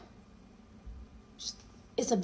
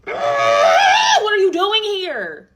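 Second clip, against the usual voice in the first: outraged scream; 10 semitones higher, 9 dB louder, much slower than usual. Retold or imitated.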